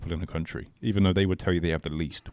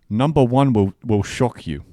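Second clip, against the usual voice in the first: clickbait video narrator; 7 dB louder than usual. Shown as a demonstration. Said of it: "This is for the clip".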